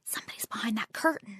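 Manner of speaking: unvoiced, hushed warning; whisper. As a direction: whisper so nobody behind the curtain hears. Friend whispering in the quiet hallway.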